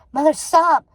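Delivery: sharp scream of mother. A narrator's reading. Daughter screaming at her mother to stop. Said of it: "screamed Hazel".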